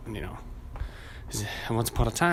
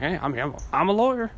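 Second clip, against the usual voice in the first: mock-confident impostor announcing himself; fake lawyer; 8 semitones higher, 3 dB louder than usual. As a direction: pitch leaping up into a loud bluff. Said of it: "walked in with a briefcase and like".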